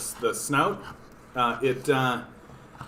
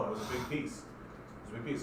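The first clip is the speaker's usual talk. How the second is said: hushed to the dog; 15 dB quieter, faster than usual. Real, not acted. Owner tells the pug about the cheese, near whisper. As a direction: hush it to him.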